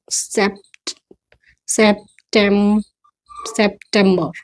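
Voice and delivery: सितंबर built up slowly, "sept... septem... septembuh"; syllable-by-syllable pronunciation. Shown as a demonstration. Teacher breaking September down, syllable-by-syllable pronunciation. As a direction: build सितंबर piece by piece the English way. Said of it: "क्या है".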